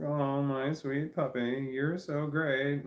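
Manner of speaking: slow, drawn-out tender praise; doting on puppy. Real, not acted. Telling her puppy he's great, slow and doting. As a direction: gush slowly over the sweet puppy. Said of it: "I'm like".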